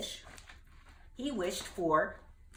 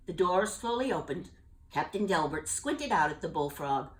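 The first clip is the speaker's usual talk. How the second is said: ordinary delivery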